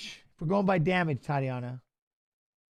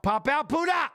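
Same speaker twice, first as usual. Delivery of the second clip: shouting to fighter; 8 semitones higher than usual. Real, not acted. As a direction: yell it across the cage at the fighter. Yelling to fighter.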